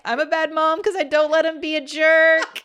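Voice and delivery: high, loud tearful wail; hammed-up tearful confession; mock crying. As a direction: wail it like a weepy mom. Retelling crying to the mentor.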